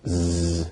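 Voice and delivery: "z" as a held, buzzing sound; voiced z. Shown as a demonstration. Teacher contrasting two sounds, "z" as a long voiced buzz. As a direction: sustain "z" with vocal cord vibration. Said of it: "so it's a voiced sound".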